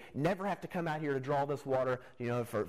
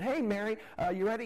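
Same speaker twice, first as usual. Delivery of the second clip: calling out to a friend; 8 semitones higher than usual. Retold or imitated. Neighbour ladies calling Mary to fetch water, cheerful call. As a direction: sing out her name from the street.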